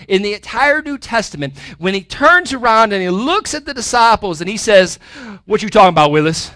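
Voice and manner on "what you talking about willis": mock sitcom kid, louder; a hammed-up sassy kid retort; sitcom catchphrase. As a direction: throw out the TV catchphrase as a gag. Joke misquote of Jesus's question.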